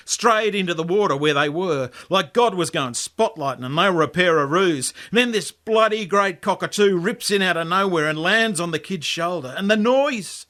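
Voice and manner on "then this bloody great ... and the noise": usual speaking voice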